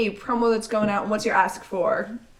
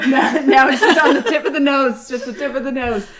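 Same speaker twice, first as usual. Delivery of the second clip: laughing; 4 semitones higher, 8 dB louder than usual. Real, not acted. Teasing about the sneeze, laughing.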